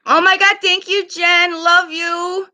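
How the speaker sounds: high, gushing thank you; high excited gratitude. Real, not acted. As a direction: gush with delight. Thanking a viewer for gift.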